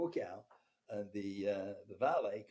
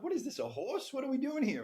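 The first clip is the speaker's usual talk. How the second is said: incredulous; 13 semitones higher, 4 dB louder than usual. Retold or imitated. Incredulous at being asked to inspect her.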